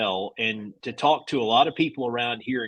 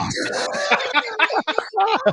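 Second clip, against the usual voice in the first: high burst of laughter. Real, not acted. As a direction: laugh out high and hard at your own joke. Laughing at own story punchline.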